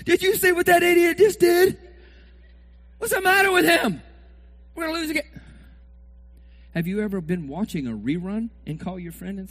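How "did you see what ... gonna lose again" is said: high, loud exasperated rant; mock irate fan. Preacher plays a fan ranting on the phone. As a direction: vent to a friend about the idiot.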